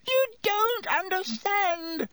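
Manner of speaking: slow, very high whine; whined. A narrator's reading. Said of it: "whined Pettigrew".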